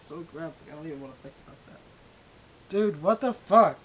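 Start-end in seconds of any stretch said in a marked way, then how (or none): 2.7-3.8 drawn-out, higher exasperated outburst; real exasperated disbelief; loud disbelief. Real, not acted. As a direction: exclaim slowly and loudly, appalled. Appalled by own voice.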